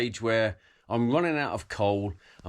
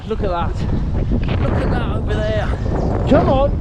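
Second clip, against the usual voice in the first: encouraging shout; 9 semitones higher, 6 dB louder than usual. Real, not acted. Urging cyclist uphill.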